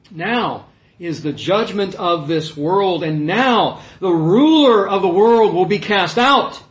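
slow declaration building to loud; solemn proclamation. Acted. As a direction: proclaim judgment, swelling as you go. Declaims Jesus' pronouncement of judgment.

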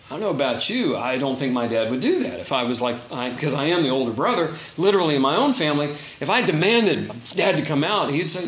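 normal talking voice

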